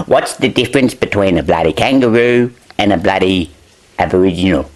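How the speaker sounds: normal talking voice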